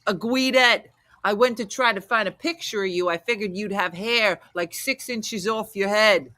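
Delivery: exaggerated New Jersey accent; imitating Jersey caller. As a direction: play up the caller's Jersey talk. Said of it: "That's how he said it".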